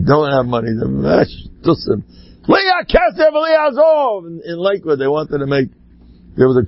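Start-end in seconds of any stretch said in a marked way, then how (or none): 2.5-4.2 high, ringing proclamation; exclaimed quotation. Retold or imitated. Proclaiming the rabbi's saying about money, high exclamation. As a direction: cry it out high like a slogan.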